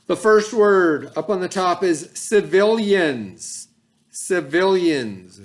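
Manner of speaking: civilians said slow and loud, each syllable clear; slow clear word model. Shown as a demonstration. Teacher models the vocabulary word for students, slow and clear. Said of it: "Please read that".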